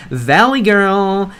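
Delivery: put-on "valley girl" stretched slow and high; exaggerated lilt on "valley girl". Shown as a demonstration. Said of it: "I'm kind of confusing the val".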